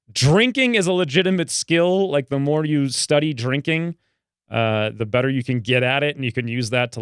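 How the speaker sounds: ordinary talk, nothing marked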